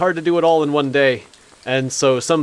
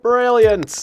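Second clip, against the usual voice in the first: excited; 8 semitones higher, 4 dB louder than usual. Real, not acted. Excited cheer in game.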